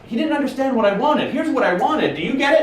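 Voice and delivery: high, pushy boss voice; hammed-up impatient boss; mock demanding boss. Boss pressing the hired worker, high and pushy. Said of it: "and I'm like".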